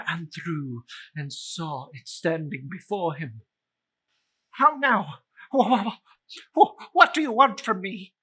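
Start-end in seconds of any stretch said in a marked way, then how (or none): 4.5-8.1 higher, louder, stammering fast with fear; frightened stammer. Acted. Scrooge confronts the ghost, stammering in higher, louder fear. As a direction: trip over the words in panic.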